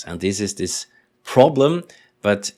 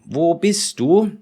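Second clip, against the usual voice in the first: calling grandma; 9 semitones higher, 4 dB louder, slower than usual. Acted. Grandma asks boy where he is.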